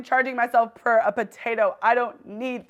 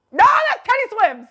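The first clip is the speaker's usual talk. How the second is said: fast, high, loud recognition; excited shout; 10 semitones higher, 5 dB louder, faster than usual. Real, not acted. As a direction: blurt it out loudly in excitement.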